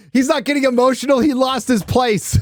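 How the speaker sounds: a sly high jab at the clip; mocking. Real, not acted. Scoffing at Harvey's clip, high and mocking.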